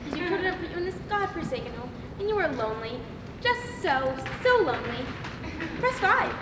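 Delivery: phone recording overcome by loneliness; desperately lonely. Acted. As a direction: pour out the loneliness. Phone menu turns desperately lonely.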